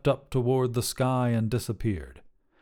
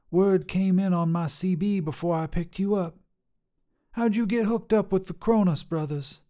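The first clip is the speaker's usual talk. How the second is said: higher, bold trucker woman voice; tough lady trucker; 8 semitones higher than usual. Acted. Trucker Sharon sizing up a hitchhiker, brash and higher. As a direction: call out like a brash long-haul trucker.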